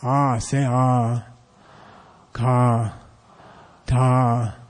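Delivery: long, low voiced 'Ah', 'Ka', 'Ta'. Shown as a demonstration. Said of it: "some are low tone. They go like this".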